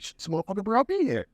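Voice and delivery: high put-on voice, retelling his shock; squeaky mock outrage at his friend; mock shocked self. Telling the cigar bar crew about his first visit, mock outraged high voice.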